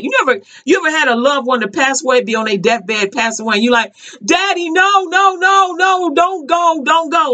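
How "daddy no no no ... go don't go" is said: put-on high, drawn-out pleading as a child. Child at father's deathbed begging, pleading. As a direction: beg daddy not to go, voice up.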